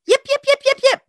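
high-pitched rapid yips; little dog barking. Retold or imitated. Storyteller imitating a dog by her car, high yapping. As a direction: yap like an excitable little dog.

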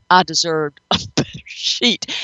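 laughing through the words. Real, not acted. Psychic retelling the cat's complaint about the sheet, laughing. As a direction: break into a laugh mid-quote. Laughs while quoting the fussy cat.

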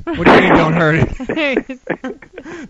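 drawn-out, high and loud disbelief; incredulous joke to the guest, high and loud; mock outrage. Real, not acted. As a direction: stretch out don't hurt it in outrage. Host mock outrage at spider.

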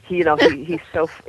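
normal talking voice

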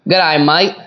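short cheery Australian greeting; quick playful Australian accent. Shown as a demonstration. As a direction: put on an Aussie twang. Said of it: "Give me a little example of Australian".

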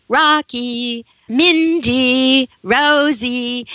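names sung out with the second syllable rising; a mild demo of calling a pet's name; happy dog call. Shown as a demonstration. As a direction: call a dog across the park, bright and lilting. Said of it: "make the second syllable go up in a high note, sound really happy".

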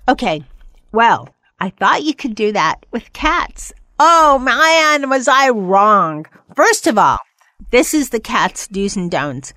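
ordinary talk

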